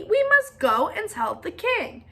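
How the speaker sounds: high, urgent call; urgent birds. Acted. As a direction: rush them along. Urgent announcement.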